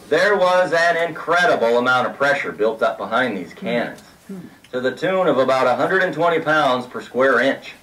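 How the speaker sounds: ordinary delivery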